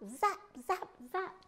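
high, punchy zaps; zap sound effect. A narrator's reading. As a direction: snap out each zap like a tongue flick.

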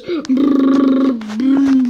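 loud brrr engine rumble; car engine noise. Pretend. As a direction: rev like a toy car.

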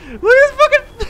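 high, breaking into laughter; laughing. Real, not acted. Gamer cracking up at crude animal models, high laughter. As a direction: crack up at the graphics.

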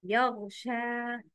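يغشى with ghain in place of kha; wrong pronunciation. Shown as a demonstration. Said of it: "but the wrong is".

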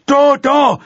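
very high, loud bellow upstairs; mother yelling up to her daughter. Acted. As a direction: bellow it at the ceiling. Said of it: "bellte sie in Richtung Zimmerdecke".

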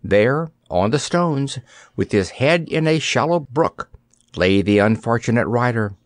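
ordinary delivery

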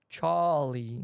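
Charlie in a British accent, R dropped; drawn-out British-accented Charlie; put-on British accent. Shown as a demonstration. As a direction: say Charlie like an Englishman, long open AH. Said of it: "Esse A R é o que mais faz você parecer inglês".

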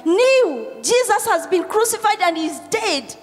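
high, loud cry of amazement; impassioned preaching. Real, not acted. Pastor marvelling at Mary at the graveyard, impassioned preaching. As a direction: raise it high and marvel aloud.

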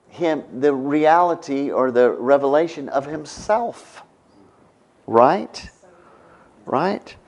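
ordinary delivery

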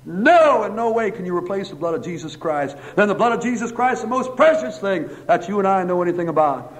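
high-pitched, forceful pulpit delivery; emphatic preaching. Real, not acted.

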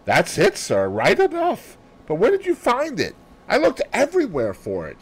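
astonished. A narrator's reading. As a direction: gasp it out in disbelief. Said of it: "eyes looked as though they would pop out".

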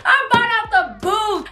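höher, lachend, halb weinend; laughing. Real, not acted. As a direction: vor Lachen kaum sprechen können. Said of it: "laugh cry".

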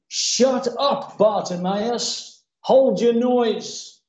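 slow, emphatic order to be silent; stern rebuke. Acted. Crowd silencing the praying beggar, sternly rebuking. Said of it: "Many charged him that he should hold his peace".

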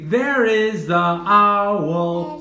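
slow, drawn-out teacher voice; slow clear modelling. Shown as a demonstration. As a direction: model it slowly for a child.

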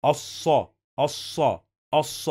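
الص said "as-sa", lam silent and s held double; doubled saad. Shown as a demonstration. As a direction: hold the heavy saad in الص before releasing the vowel. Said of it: "We pronounce this صاد two times".

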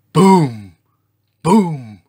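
very loud, falling "boom"; booming footsteps. A narrator's reading. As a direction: stamp out each thud with the voice.

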